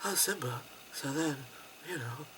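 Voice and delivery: very soft, listless imitation; low-energy delivery. Uninterested actress reading to Simba, low-energy delivery. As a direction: murmur it as if you can't be bothered. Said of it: "That's not the same energy".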